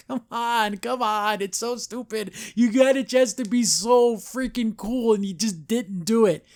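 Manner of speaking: high, pleading complaint; exasperated whining. Real, not acted. Reviewer laments Luz's missed chance to be cool, exasperated. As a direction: plead in a high, fed-up whine. Exasperated at silly story choice.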